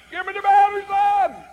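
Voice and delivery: piercing, high-pitched full-volume yell; yelling old lady. Retold or imitated. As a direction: holler it high at the top of an old lady's voice. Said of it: "and she yelled".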